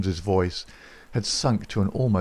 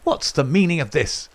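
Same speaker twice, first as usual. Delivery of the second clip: higher, dry demand; dry, rasping; 7 semitones higher than usual. A narrator's reading. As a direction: ask with a dry rasp. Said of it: "in a dry, rasping tone".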